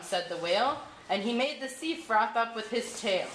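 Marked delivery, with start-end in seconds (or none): none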